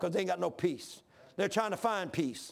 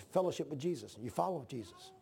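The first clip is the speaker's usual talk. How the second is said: much softer, lower, fighting tears; still choked; 5 semitones lower, 7 dB quieter than usual. Real, not acted. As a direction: let the words fall away in real grief. Still overcome after the story.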